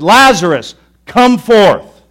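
full-voiced, commanding shout; a booming command to wake the dead. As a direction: call the dead man out of the tomb. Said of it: "the idea is he shouted".

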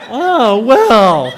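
very high, loud mock discovery; hammed-up sudden realization, voice leaping up; comic realization. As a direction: exclaim as if just spotting the obvious. Acts Hagar suddenly noticing the well.

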